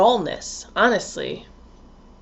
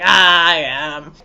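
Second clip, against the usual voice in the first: slow, low mock wail; hammed-up Cancer admission; 6 semitones lower, 4 dB louder, slower than usual. Teased Cancer owning up, hammed-up and drawn out. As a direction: drag it out low in mock self-recognition.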